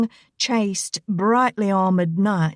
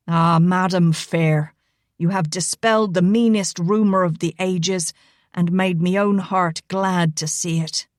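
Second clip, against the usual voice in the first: Irish brogue. Acted. Rigo applauding Elena, mock-gallant Irish brogue. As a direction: flatter her with a gallant Irish lilt.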